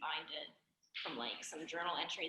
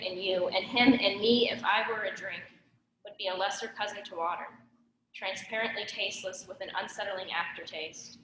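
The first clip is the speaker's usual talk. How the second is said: insistent spoken-word delivery, often louder; poem recitation; 13 dB louder than usual. Real, not acted. Poet reads a poem written at seventeen to an audience, spoken-word recitation. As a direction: project the poem to the room, phrase by phrase.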